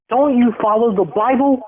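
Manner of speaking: high, pleading question; mock wounded appeal; indignant plea. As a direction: appeal to her faith with a whine. Voicing him pleading with her.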